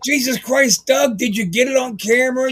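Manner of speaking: high, urgent mock exclamation; acting out an excited outburst; retold frantic excitement. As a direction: blurt it high, as if just amazed. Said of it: "And I was like".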